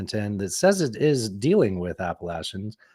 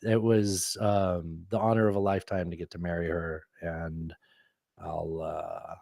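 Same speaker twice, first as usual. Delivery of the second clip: low, soft and tender; hushed, sincere newlywed contentment; heartfelt; 6 semitones lower, 9 dB quieter than usual. Real, not acted. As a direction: speak quietly from the heart, smitten. Heartfelt talk about marrying Natasha.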